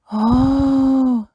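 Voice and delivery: long, high, loud gasp of delight; Peppa Pig. Acted. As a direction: stretch the oh in wonder. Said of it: "says Peppa".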